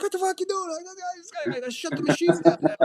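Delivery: high-pitched mock protest; high, hurried exasperation, acted out; exasperated bar guy.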